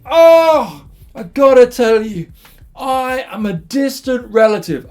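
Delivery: high, loud acted outburst; gushing suitor. Jacob declares himself to Rachel at the well, gushing. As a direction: burst out with an overwrought confession.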